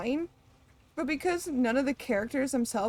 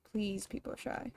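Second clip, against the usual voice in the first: much softer and lower, nearly under the breath; soft repeat; 4 semitones lower, 10 dB quieter than usual. Shown as a demonstration. Streamer repeating her plea to the characters in a soft hushed voice. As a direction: drop it to a hush the second time.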